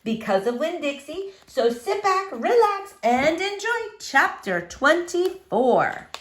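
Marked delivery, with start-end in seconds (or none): none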